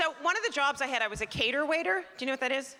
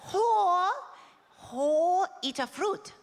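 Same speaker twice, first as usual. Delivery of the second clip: mock Japanese accent; 8 semitones higher, much slower than usual. Princess holding a peach to the waitress, mock accent. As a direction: coax in a put-on Japanese princess voice. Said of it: "referring to me as".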